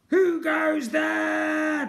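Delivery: high, loud, drawn-out challenge; demanding shout. A narrator's reading. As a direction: bellow a slow challenge at an intruder. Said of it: "demanded a voice".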